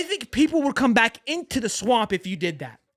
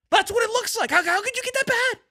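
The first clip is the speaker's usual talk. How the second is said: much higher, strained disbelief; exasperated disbelief; 11 semitones higher than usual. Real, not acted. Fan ranting on stream about his team's collapse, exasperated disbelief. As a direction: throw the question up in despair.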